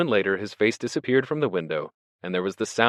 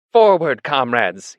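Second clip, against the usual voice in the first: raised, loud rallying word that falls away; Squealer quoting Boxer; 5 semitones higher, 8 dB louder, much slower than usual. A narrator's reading. Squealer quoting the dying Boxer, declaiming. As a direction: proclaim the last words, then drop off. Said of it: "he whispered".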